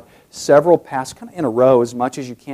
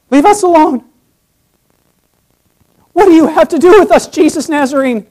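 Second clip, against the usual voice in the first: high, loud desperate plea; begging demon; 14 semitones higher, 7 dB louder, faster than usual. Acted. Demon begs Jesus to leave, voiced in panic. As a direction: beg for your life, fast. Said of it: "He's almost begging for his life".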